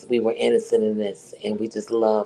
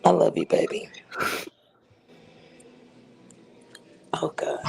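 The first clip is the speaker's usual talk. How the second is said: whisper; 4 semitones higher than usual. Real, not acted. Saying goodbye to Conscious, whispered. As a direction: breathe it out, overcome.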